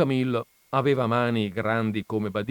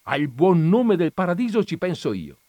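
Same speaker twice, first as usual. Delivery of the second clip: high, loud irritated retort; annoyed shout; 9 semitones higher, 8 dB louder than usual. A narrator's reading. Jesus shouting at Don Camillo, annoyed. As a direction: snap back, cutting him off. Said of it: "gridò seccato Gesù".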